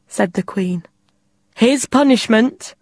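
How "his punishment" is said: slow, formal royal announcement; announcing. Acted. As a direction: proclaim it to the court. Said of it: "she announced".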